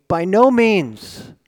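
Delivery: slow, emphatic By no means; forceful denial. Acted. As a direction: hammer it out slowly. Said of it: "Paul's saying it in a very forceful way".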